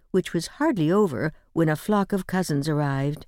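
ordinary delivery

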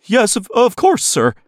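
high-pitched flustered assistant; nervous gulp. Acted. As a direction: falter anxiously before the boss. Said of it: "the technician said with a nervous gulp".

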